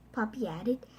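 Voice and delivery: usual speaking voice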